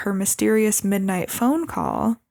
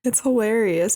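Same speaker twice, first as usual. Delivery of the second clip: ordinary delivery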